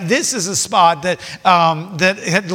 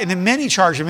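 normal talking voice

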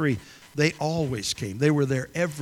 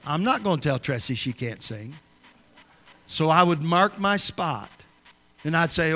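normal talking voice

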